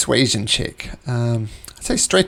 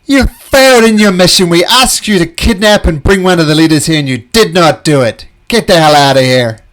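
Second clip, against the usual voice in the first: loud, higher furious bugbear shout; angry bugbear; 5 semitones higher, 12 dB louder than usual. Acted. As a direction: bark them out of the room, furious. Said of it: "the two bugbears come up and said".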